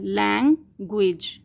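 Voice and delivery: लैंग्वेज slowly in two beats, lang, gwej; split into syllables. Shown as a demonstration. Teacher showing two syllables, लैंग्वेज broken slowly in two. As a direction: pause inside लैंग्वेज between lang and gwej. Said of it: "टू syllables रखता है".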